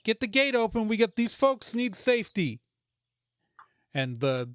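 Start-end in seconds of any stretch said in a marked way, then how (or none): 0.0-2.6 higher, calling up to open the gate; yelling order. Acted. Guard yells up to Mikey to open the gate. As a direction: shout to a guard up high.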